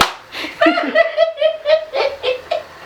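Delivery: long squealing laugh, high up. Real, not acted. Grandma laughing at the dog, high squealing laugh. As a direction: let a high giggle run out.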